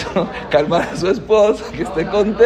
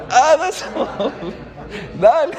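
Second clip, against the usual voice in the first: laughing exclamation; 6 semitones higher than usual. Real, not acted. Jokey exclamation.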